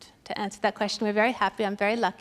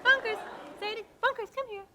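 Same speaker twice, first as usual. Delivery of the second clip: coaxing the dog; 9 semitones higher, 5 dB quieter than usual. Acted. Coaxing her dog to speak.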